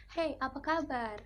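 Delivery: hey, apa kaba in a strong eastern Indonesian lilt; eastern Indonesian accent. Shown as a demonstration. A student demos an eastern Indonesian greeting, strong eastern Indonesian accent. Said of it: "orang Indonesia bagian timur".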